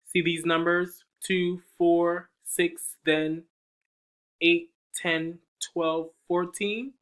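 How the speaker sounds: bright storytime counting; counting to children. Acted. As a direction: count by twos for the kids.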